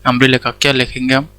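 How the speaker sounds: ordinary talk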